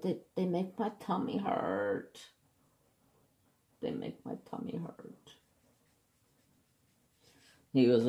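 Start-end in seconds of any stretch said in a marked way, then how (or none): 0.0-2.3 higher, softer little whine; whiny complaint. Retold or imitated. Patient moaning to the doctor about iron pills, whiny. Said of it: "you're a whiner". 3.8-5.3 barely voiced, pitiful whimper; low, feeble whisper of a whine; whimpered complaint. Patient repeating the complaint to the doctor, feeble whimper. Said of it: "you're a whiner".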